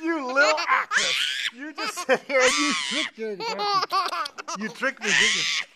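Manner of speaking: high laughing teasing. Real, not acted. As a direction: tease her through real laughter. Dad laughing at fake crying.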